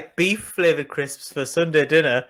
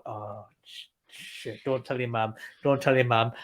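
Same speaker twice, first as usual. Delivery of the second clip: hushed, guilty pleading, much softer; panicked hushed dad; 7 semitones lower, 7 dB quieter than usual. Retold or imitated. Dad begging his toddler not to tell mum, hushed and guilty. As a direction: beg the child to keep the secret, under your breath. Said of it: "The dad's going".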